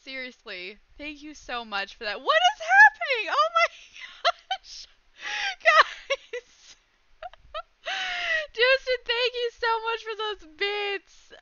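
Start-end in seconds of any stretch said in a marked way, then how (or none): 2.2-11.3 high, shrieking laughter and thanks; real overwhelmed high squeal; giddy disbelief. Real, not acted. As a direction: scream in happy shock, then gush. Gifts keep pouring in.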